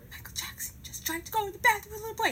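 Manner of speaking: fast, high-pitched frantic report; freaking out. Retold or imitated. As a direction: blurt the alarm out fast and high. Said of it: "freaking out".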